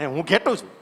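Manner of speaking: low, timid answer with a laugh; meek laughing reply. Acted. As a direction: giggle it out like a shy lamb. Cub insists, laughing.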